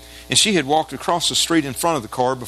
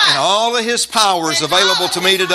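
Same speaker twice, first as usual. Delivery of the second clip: higher, louder call-and-response preaching; preaching declaration; 8 semitones higher, 5 dB louder than usual. Real, not acted. Preacher having congregation repeat walking with God, forceful declaration. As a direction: lead the congregation phrase by phrase, forcefully.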